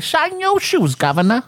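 mock Cockney shoeshine, pitched high. Showing friends how Cockneys talk, high-pitched Cockney accent. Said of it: "they have the high pitch".

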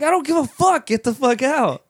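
very high-pitched, exasperated yell; reenacted fed-up outburst, voice shooting up; acted angry dismissal. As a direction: cut the visitor off and kick him out. Retold dismissal of the visitor.

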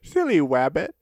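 "wabbit" with a cartoon w in place of r. Host riffs on the slip, saying "wabbit" with w for r. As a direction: turn the r of "wabbit" into a babyish w.

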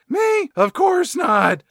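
high, loud mock innocence; put-on squeaky denial; Brockman's teen voice. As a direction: deny it slowly in a high kid voice. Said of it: "this Brockman guy's like".

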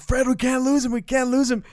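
high, loud frantic shout at the driver; retold panicked cry to a friend driving; panicked yell. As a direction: yell in a panic to lose the cop. Panicked shout during police chase.

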